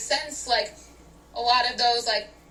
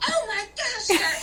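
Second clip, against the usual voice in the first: high, squealing mock excitement; excited squeal; 11 semitones higher than usual. Imitating her friend spotting a shark, squealing excitedly. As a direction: squeal like a fan seeing a shark. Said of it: "And you go".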